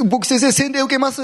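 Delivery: high, pleading voice, a touch of tears; blurting it out with mild tears; tearful confession. Retold or imitated. Scientist tells pastor he will be baptized, mildly tearful and high. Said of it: "って涙ながらに言って".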